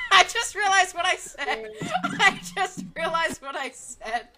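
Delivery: loud, high, slow laughing words; laughing hysterically. Real, not acted. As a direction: crack up while saying it. Laughing at own slip.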